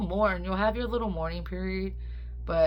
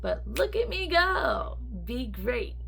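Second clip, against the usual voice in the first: much higher, bright fake-cheery brush-off; mock upbeat; 11 semitones higher, slower than usual. Telling her doctor she's fine, in a mock upbeat voice. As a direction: pretend everything's fine, hammed up. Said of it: "And I'm like".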